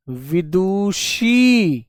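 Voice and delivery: Vidushi drawn out very slowly and softly; slow dictation. Shown as a demonstration. Teacher dictates Vidushi to pupils, very slow and soft. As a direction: spell it out with the voice.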